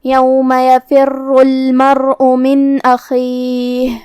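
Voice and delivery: slow, drawn-out tajweed chant; measured melodic Quranic recitation. Shown as a demonstration. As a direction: recite it slowly with full elongation.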